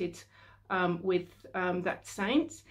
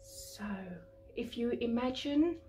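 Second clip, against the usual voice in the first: guided meditation. Acted. Healer guiding a listener through violet flame cleansing, guided meditation. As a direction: lead them calmly through the imagery, unhurried.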